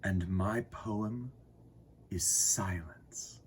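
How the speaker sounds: slow, hushed whisper; soft, slow unvoiced whisper. A narrator's reading. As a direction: breathe the word silence. Poem of silence.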